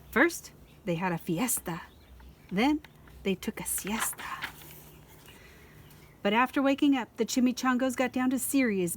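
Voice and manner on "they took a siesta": breathy sleepy whisper; hushed storyteller whisper. A narrator's reading. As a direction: whisper it like they're napping. Narrator hushes for siesta.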